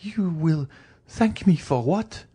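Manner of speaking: high, frightened woman's question; high-pitched disbelief; bewildered dread. Acted. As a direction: ask in high, horrified confusion. Bewildered wife in terror.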